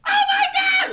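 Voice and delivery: sudden high shriek of disbelief; a real jolt of shock, way up high; my God flung out in shock; shocked exclamation. Real, not acted. Shocked at the played clip.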